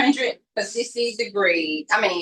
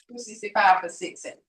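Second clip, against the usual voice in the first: ordinary talk, nothing marked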